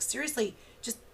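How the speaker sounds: high, loud, sighing knock it off; exasperated parent. Retold or imitated. Parent losing patience with a melting-down child, exasperated. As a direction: let the exasperation burst out. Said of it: "my response sometimes is like".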